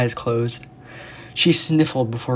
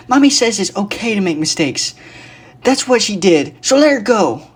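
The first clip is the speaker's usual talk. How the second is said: put-on little girl pleading; 9 semitones higher, 6 dB louder than usual.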